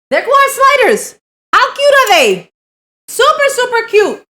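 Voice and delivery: high, loud and gushing; excited gushing. Real, not acted. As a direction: gush over something adorable. Gushing over cute sliders.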